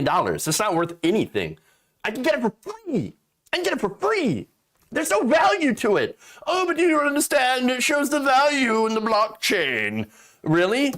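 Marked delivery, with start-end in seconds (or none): 2.0-6.2 rising exasperated rant. Real, not acted. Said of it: "ranting at NFT value". 6.4-10.1 whiny, much higher mock believer voice; high mock crypto bro. Comedian mocking an NFT defender in a high whiny voice.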